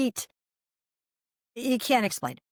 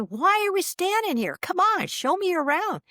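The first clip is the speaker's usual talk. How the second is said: high-pitched, excited invitation; reenacted impatient delight, pitched way up; eager urging; 11 semitones higher than usual. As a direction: push the friend to get going. Eagerly asking friend for a tour.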